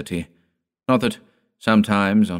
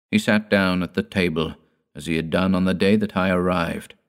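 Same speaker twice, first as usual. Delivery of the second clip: plain reading voice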